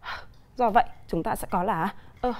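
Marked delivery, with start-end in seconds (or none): 0.0-0.1 H as a bare puff of breath, "hh"; softly exhaled H with no clear vowel; consonant sound H. Shown as a demonstration. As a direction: sound H alone, not its letter name. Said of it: "là một phụ âm được phát âm".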